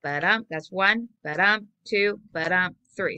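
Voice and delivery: "ba-dum" stressed on "dum", then the count; counting iambic feet. Shown as a demonstration. Teacher counting trimeter feet for the class. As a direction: tick off each foot aloud. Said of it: "That would be three".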